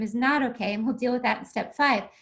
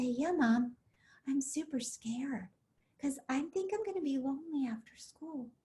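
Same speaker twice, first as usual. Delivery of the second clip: put-on hushed child's confession, near whisper; confiding scared child; 8 dB quieter than usual. Child brings his pain to mom, quietly confiding. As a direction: softly let the worry out to Mom. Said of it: "he'll probably start talking to me and say".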